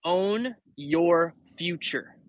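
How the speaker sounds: very slow, spaced out; slow and deliberate. Shown as a demonstration. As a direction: spell it out word by word. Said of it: "it is the phrase".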